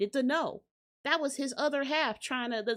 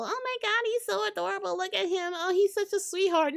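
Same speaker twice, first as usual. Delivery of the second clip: much higher, cooing over a cute guy; gushing fan voice; 8 semitones higher, faster than usual. Retold or imitated. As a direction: gush like a fan who adores him. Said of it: "People think that Kendrick is just this cute little".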